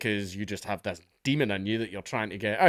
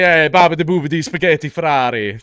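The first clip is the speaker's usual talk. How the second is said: much louder, higher cartoonish mock Italian accent; 4 semitones higher, 10 dB louder than usual. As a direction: ham up a silly Italian caricature, loud and high. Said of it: "if I wanted to be racist".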